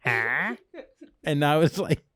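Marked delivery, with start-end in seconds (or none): 0.0-0.6 confused grandma. Retold or imitated. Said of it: "she's like".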